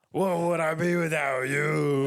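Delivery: [name] crooning, sounding like Cookie Monster; [name] impression. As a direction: growl it out like [name], slow. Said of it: "Sound like Cookie Monster".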